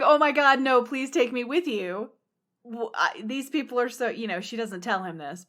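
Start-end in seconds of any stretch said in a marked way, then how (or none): 0.0-2.1 pleading loudly, voice jumping up; begging Vasilia. Retold or imitated. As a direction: beg to be taken along. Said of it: "Vasilia's like".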